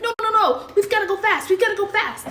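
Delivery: high, loud rushing mom; pushing her scared child to hurry; urgent hurry. Retold or imitated. Mother rushing her daughter down airport stairs, high urgent pleading. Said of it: "And I'm like".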